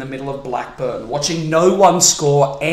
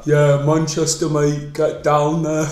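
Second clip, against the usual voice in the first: matey mock-Manchester accent; attempted British accent; slower than usual. Foreigner playing a Manchester local to a friend, attempted British accent. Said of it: "Two foreigners trying to do a British accent".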